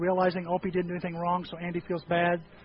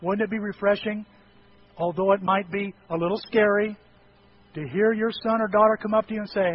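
ordinary delivery

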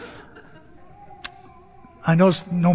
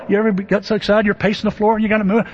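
usual speaking voice

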